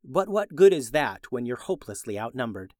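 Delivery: ordinary delivery, nothing marked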